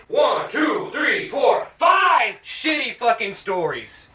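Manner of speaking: each number punched, slowing on five shitty fucking stories; counting emphatically. Real, not acted. Reviewer counting off the stories, emphatic and slowing. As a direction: count them off to drive it home. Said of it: "counting out five stories".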